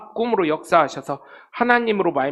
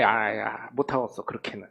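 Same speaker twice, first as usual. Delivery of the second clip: muttered aside; 8 semitones lower, 8 dB quieter than usual. Real, not acted. Aside about what he cannot say.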